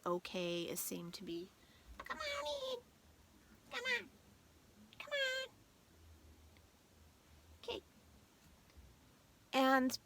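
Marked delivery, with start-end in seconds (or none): 2.1-7.8 high sing-song pet call, much higher and softer. Real, not acted. Calling her pet Momo inside.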